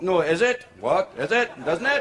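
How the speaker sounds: confused sing-song Welsh questions; drunk Welshman. Acted. Drunk Welshman muddles his tags, rising Welsh accent. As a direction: let each tag climb in a Welsh lilt. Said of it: "talking to a Welshman pissed".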